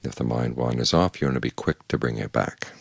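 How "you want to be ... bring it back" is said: ordinary talk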